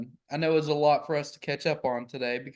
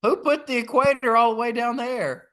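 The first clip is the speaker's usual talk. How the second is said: louder, higher outraged disbelief; incredulous; 7 semitones higher, 6 dB louder than usual. Real, not acted. Friend questioning the map, loud incredulous outrage. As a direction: demand to know who got it so wrong.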